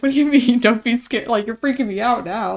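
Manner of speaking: loud, half-laughing disbelief; incredulous. Retold or imitated. Coworker retelling her reply to a worrying group message, incredulous.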